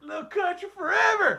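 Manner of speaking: high, loud mock anthem cry; hammed-up country holler; hipster country guy. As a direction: echo the country battle cry. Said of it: "He's a hipster country guy".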